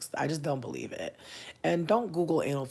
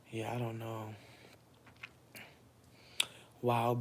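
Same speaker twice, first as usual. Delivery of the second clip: ordinary delivery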